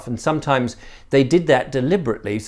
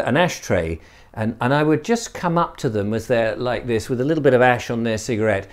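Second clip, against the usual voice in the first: ordinary delivery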